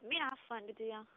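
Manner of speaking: thin phone line voice; over the phone. Real, not acted. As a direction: heard through a phone speaker. Doctor's voice heard via phone.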